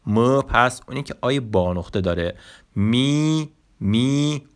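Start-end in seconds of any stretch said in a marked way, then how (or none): 2.8-4.4 می، drawn out and held high as a model sound; teacher modelling the suffix می، clearly; suffix demo. Shown as a demonstration. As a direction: say می، twice, slow and clear for learners. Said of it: "ما چهار تا پسوند سوالی داریم".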